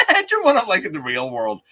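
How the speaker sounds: "Imagine" said high and loud through a laugh; laughing repeat. Real, not acted.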